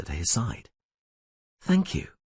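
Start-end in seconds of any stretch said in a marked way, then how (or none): none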